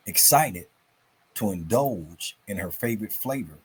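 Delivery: put-on lively storybook reading for kids; story narration. A narrator's reading. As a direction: tell it like a bedtime story, bright and animated. Reads story to children.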